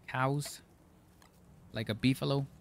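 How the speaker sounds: usual speaking voice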